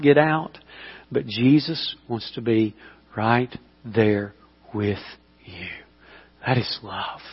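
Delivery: hushed altar-call voice; tender. Acted. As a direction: draw them in quietly with love. Soft close over music.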